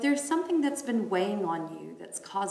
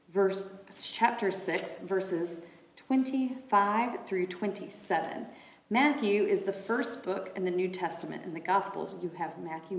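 usual speaking voice

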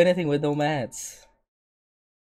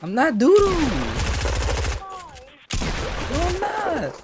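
much higher, whiny protest; insisting he's not bad; indignant denial. Real, not acted. Streamer protesting to his girlfriend, high and indignant.

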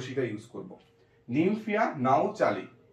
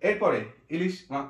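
normal talking voice

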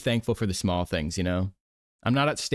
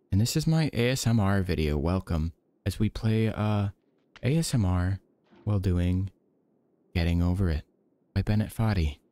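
usual speaking voice

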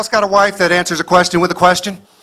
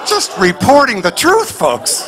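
mock indignant shout; 6 semitones higher, much slower than usual. Mock-righteous punchline shout.

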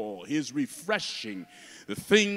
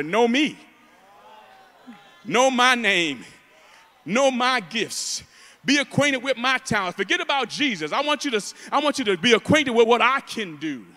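loud, raised voice of a show-off; demanding self-promoter; 6 semitones higher, 8 dB louder than usual. Acted. Preacher mimicking glory-seekers in church, loudly demanding. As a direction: preach it as a mock boast, punched out in bursts.

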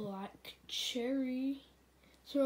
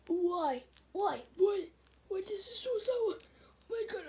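high-pitched, plaintive sour-candy wail; mock wailing; 7 semitones higher, 4 dB louder than usual. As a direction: wail in protest at the sourness. Contestant wails at sour candy.

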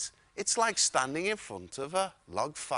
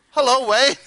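laughing; 6 semitones higher, 12 dB louder than usual. Retold or imitated. Amused sleepy guest replies.

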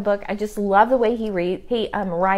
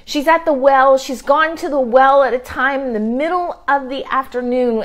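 ordinary talk, nothing marked

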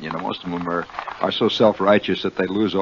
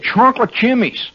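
"chocolate chimneys" in Jersey accent; mock-local Jersey ice cream order; New Jersey accent; 12 semitones higher than usual. Shown as a demonstration. Host demonstrates what natives get at Carvel's, in Jersey accent. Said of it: "they have their own language".

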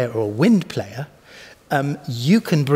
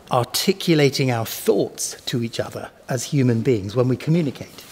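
ordinary delivery

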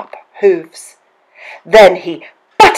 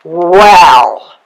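loud growled troll voice; troll's growl; 8 dB louder than usual. A narrator's reading. Troll weighs up the second goat's offer, growling. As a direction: growl it out loudly. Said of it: "growled the troll".

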